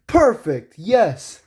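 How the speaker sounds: pleased, high and loud cartoon voice; Sonic. Sonic finding the right shoes, pleased exclamation. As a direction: exclaim each word with a falling swoop. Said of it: "He goes like".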